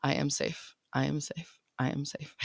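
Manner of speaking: soft, repeated self-soothing mantra; calm mantra. Shown as a demonstration. Mom calming her own nervous system, soft mantra. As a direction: say it softly to yourself like a mantra. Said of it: "I on purpose mantra the thought".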